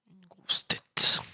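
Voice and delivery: slow unvoiced whisper. Real, not acted. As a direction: whisper it so nobody hears. Sniping quietly, hidden.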